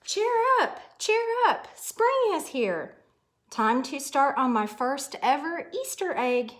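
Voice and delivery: high, bright little bunny voice; a chirpy young rabbit full of hope; cheerful bunny Hoppy. Acted.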